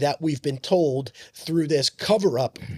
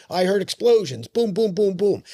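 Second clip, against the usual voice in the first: quoting a witness with a raised, emphatic voice; imitated eyewitness report; 5 semitones higher, 3 dB louder than usual. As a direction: punch out each boom like a blast. Quoting police witnesses at the site.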